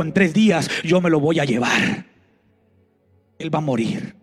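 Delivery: lightly voiced death pronouncing doom. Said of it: "la muerte dijo".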